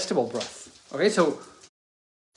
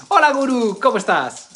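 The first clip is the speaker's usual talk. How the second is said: cheerful greeting; 8 semitones higher, 5 dB louder than usual. Real, not acted. Greeting someone by name.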